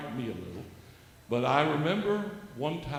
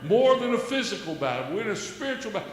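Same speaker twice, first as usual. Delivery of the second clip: loud, higher preacher's proclamation; preaching at full force; 8 semitones higher, 10 dB louder than usual. Acted. Exhorting the host and viewers, loud high preaching. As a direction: proclaim it like from the pulpit.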